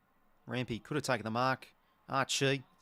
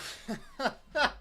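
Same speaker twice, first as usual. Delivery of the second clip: high rising giggle of disbelief; 11 semitones higher than usual. Real, not acted. Fan giggling at the ridiculous bounce, high giggle. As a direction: let a giddy giggle out.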